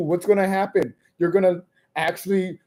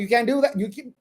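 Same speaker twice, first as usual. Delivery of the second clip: high indignant outrage; 4 semitones higher than usual. Retold or imitated. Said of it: "And I was like".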